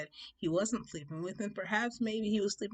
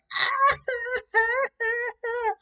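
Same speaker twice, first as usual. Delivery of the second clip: loud high-pitched mock bawling; hammed-up wailing sobs; boo-hooing; 9 dB louder than usual. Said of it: "I'm boo-hooing like".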